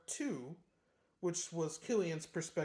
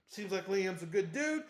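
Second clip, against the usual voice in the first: normal talking voice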